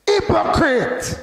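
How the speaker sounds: slow, high, loud shout; forceful rebuke. Shown as a demonstration. Preacher showing how Jesus rebuked hypocrites, forceful shout. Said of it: "No, he said".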